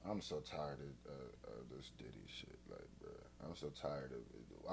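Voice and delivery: low, soft, fed-up mumble; weary. Real, not acted. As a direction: sigh it out, done with it. Fed up with [name] story.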